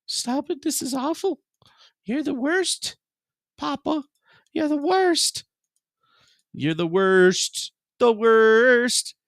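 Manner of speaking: high squeaky mock whine from the pet; voicing the dog. Owner voices his dog whining at him, high mock protest. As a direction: pipe it up high as the dog complaining. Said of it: "she's like".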